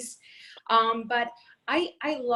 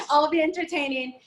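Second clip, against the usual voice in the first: laughing; 6 semitones higher than usual. Real, not acted. Laughing self-deprecating joke.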